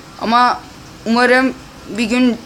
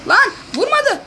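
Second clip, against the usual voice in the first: much higher, exasperated cry; outraged disbelief; 11 semitones higher than usual. Real, not acted. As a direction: squeal in disbelief at the game. Player failed to shoot.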